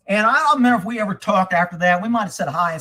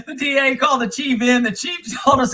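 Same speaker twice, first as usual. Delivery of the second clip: laughing; 4 semitones higher than usual. Real, not acted. Laughing through story.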